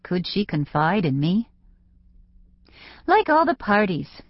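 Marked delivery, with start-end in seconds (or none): 3.1-4.2 higher-pitched teen, lightly voiced; reluctant teen confiding. A narrator's reading. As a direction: confide it at last as the young client. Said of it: "she said finally".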